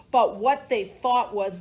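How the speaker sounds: usual speaking voice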